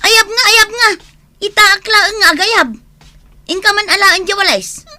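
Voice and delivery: put-on high, loud scolding at the kids; angry scolding. Mother yelling at children who ignore her, loud scolding. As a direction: tell them off sharply.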